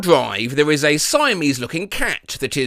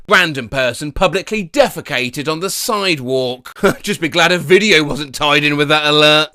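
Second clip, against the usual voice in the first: normal talking voice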